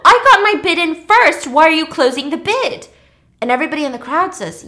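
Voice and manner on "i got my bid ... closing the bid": loud, higher angry protest; indignant outburst in the retelling; pissed. Retold or imitated. Angry woman bidder protests losing the painting, loudly indignant. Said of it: "she's pissed by this".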